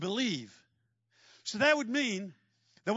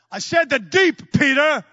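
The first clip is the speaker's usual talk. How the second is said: louder, higher, slow and firm; firm insistence; 6 semitones higher, 12 dB louder, slower than usual. Acted. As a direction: lay down the law, deliberately.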